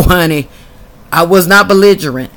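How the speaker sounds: ordinary delivery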